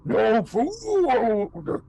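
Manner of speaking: slow mournful head. Acted. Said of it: "the deeper voice goes".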